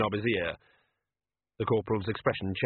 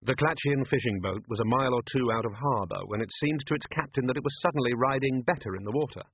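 ordinary delivery, nothing marked

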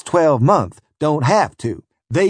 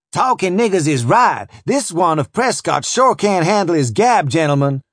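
piping overseer; 6 semitones higher, 3 dB louder than usual. Acted. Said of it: "pipes up the overseer".

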